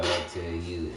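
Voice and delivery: ordinary delivery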